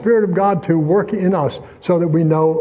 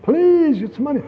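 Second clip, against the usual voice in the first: high, pleading please; begging plea; 10 semitones higher than usual. Retold or imitated. Preacher mimicking the beggar's high pleading please. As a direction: beg for coins. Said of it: "Hand, hand it".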